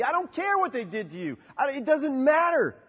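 preacher's rising rebuke; fiery preaching. Acted. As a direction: challenge the hearers, building.

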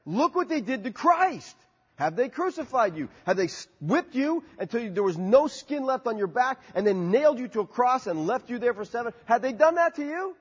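preacher's rising rebuke; fiery preaching. Acted. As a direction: challenge the hearers, building.

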